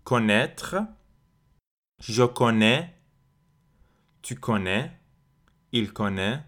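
slow, spaced-out French conjugation drill; repeat-after-me model. Shown as a demonstration. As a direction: say each form slowly, pausing for learners to repeat. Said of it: "répétez après moi".

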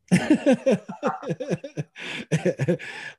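a loud high burst of laughter; real laugh. Real, not acted. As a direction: laugh out loud at yourself for crying at sports films. Laughing at his own crying.

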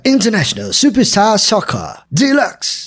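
put-on slow British announcer accent. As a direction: read the title in a British accent. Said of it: "ele é meio britânico". (sotaque britânico de locutor)

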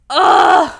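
loud, high groan of being overwhelmed; emotional groan. Real, not acted. Overcome by the emotional scene.

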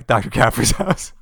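ordinary talk

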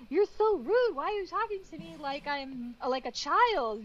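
offended teammate snapping back; mock offended partner. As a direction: scold like the hurt teammate. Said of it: "And he's just like".

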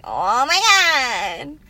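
put-on Valley Girl. Shown as a demonstration. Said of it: "I kinda sound Valley Girl".

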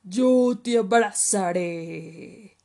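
big, very loud, slow creature voice; slow menacing-playful crocodile; crocodile character. Acted. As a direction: boom it out, stretching the last word. Crocodile offers hug.

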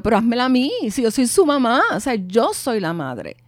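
reclamo firme y alzado; madre indignada. Retold or imitated. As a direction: exigir con indignación de madre. Madre reclama a Raquel por teléfono. (reclamo indignado de madre)

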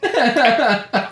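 high strained laugh on one word; trying not to laugh. Real, not acted. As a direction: fight back a laugh. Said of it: "I'm, like, holding back laughter".

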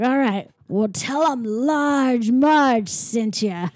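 very slow, drawn-out ominous send-off; spooky trucker woman. Retold or imitated. Older trucker dropping off hitchhiker, slow and ominous. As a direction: stretch each word into an eerie farewell. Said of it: "and she's like".

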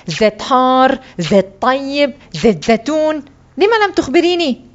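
indignant list climbing to high protest; angry. A narrator's reading. Boy blames his mother for not explaining, mildly angry. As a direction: throw the choices back crossly. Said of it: "فقلت بغضب".

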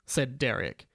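normal talking voice

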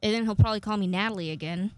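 ordinary talk, nothing marked

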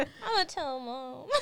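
slow, high, put-on crying whine; mock whiny tattletale. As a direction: whine it like a sulking little sibling. Mock tattling little sibling.

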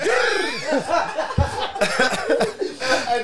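high burst of real laughter; loud laughter. Real, not acted. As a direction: crack up loudly. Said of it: "That meme is ten out of ten".

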